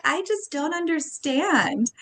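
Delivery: high, slow, drawn-out parental dismay; mock bewilderment. Retells confronting her son.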